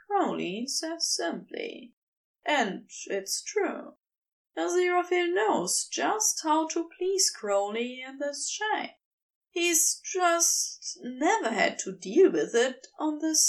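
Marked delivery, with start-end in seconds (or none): none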